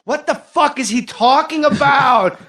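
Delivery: high, loud, drawn-out exasperation; imitated heckler's shout; heckler's outburst. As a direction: yell it out like a baffled audience member.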